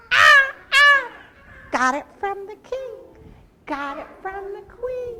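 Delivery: high, loud squawking toucan; bird sound. Shown as a demonstration. Storyteller showing children the bird sound, high squawks. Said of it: "Can you do the bird sound?".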